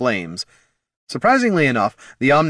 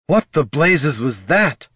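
slow, louder holler; 5 semitones higher, 4 dB louder, slower than usual. A narrator's reading. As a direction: call it out over the fight, slowly. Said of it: "hollered Loruna".

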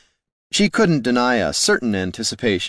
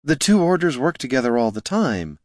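shooting back; 5 semitones higher than usual. A narrator's reading. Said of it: "Loruna shot back".

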